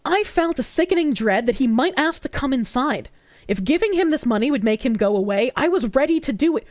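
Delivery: low, even reading voice; article reading. A narrator's reading. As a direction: read the story out steadily. Reads article aloud.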